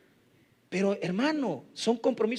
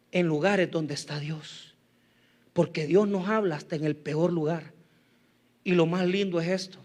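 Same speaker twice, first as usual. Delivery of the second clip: ordinary talk, nothing marked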